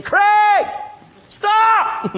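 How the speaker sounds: high, loud cry of stop; warning shout. Retold or imitated. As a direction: shout him down like an inner alarm. God's voice warning, retold.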